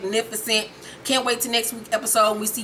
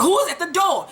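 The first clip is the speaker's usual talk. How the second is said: high-pitched mock outrage; agitated demand; 6 semitones higher than usual. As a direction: demand it high and impatient, imitating him. Acted agitated repeated demand.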